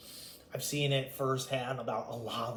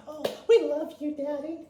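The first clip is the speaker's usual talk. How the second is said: high, loud sugary mimic of fawning siblings; mimicking siblings fawning over their father in high fake sweetness; mocking fake sweetness; 13 semitones higher, 9 dB louder than usual. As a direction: sneer it out like a fake-loving child. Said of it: "They say".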